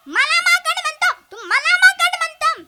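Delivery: high, loud indignant outburst; offended teacher. Acted. Teacher to pupil, loud indignant complaint. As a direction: bristle at being called a monkey.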